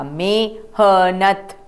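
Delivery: मेहनत drawn out very slowly syllable by syllable; slow sounding out. Shown as a demonstration. Teacher to class, slow sounding out of the word. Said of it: "म को एक ही मात्रा".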